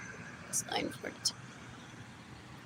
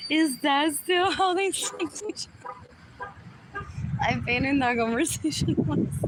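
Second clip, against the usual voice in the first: ordinary talk, nothing marked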